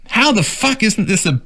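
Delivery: high, mocking outraged rant; mock-indignant quote read high; mock outrage. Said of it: "She said".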